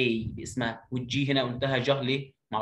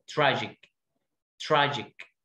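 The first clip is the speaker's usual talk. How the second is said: ordinary talk, nothing marked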